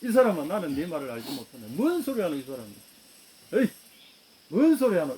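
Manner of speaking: 당황해서 크게 부인하듯; flustered loud denial. Acted. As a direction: wave it off loudly, caught out. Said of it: "베드로가 뜨끔해가 뭐라 그랬습니까".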